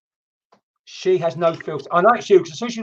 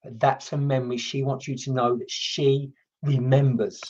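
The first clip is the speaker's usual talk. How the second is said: tender message; 5 semitones lower, 5 dB quieter, slower than usual. Acted. Delivering spirit message tenderly.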